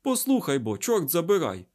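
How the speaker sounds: barked. A narrator's reading. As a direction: cut in with a sharp bark. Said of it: "гаркнув Айзек".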